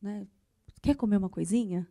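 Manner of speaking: soft, low, tender offer to a partner; gentle. Acted. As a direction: coax gently, with pauses. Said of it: "Seja gentil".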